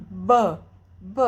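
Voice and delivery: B, B said as the bare sound, twice; letter B sound. Shown as a demonstration. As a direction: sound out B for the child. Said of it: "letra que faz esse som aqui".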